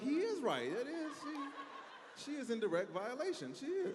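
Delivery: high, laughing flustered talk; flustered passenger. Retold or imitated. Lady doubling down to the attendant, high and flustered. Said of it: "She's like".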